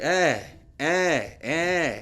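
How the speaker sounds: lightly voiced toddler's wordless call; toddler imitation. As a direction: imitate a small child pointing something out. Imitates pointing toddler's wordless noise.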